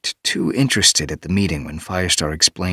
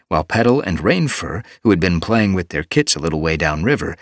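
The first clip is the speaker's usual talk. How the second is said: plain reading voice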